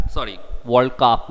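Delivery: Cup said with a short uh vowel; teacher correcting her own slip; corrected pronunciation. Shown as a demonstration. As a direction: World Cup, carefully saying Cup as kuhp, not cap. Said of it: "not cap".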